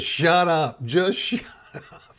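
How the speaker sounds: high, half-laughing plea to shut up, slowing down. Retold or imitated. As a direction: cousin trying not to crack up. Cousin hushing him at funeral.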